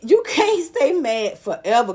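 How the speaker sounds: ordinary talk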